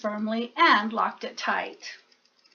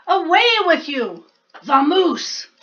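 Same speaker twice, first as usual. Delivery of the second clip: loud, higher shooing order; shooing shout; 5 semitones higher, 6 dB louder than usual. Acted. Bear shooing the mouse away, loud. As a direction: chase the mouse off. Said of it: "rumbled Bear".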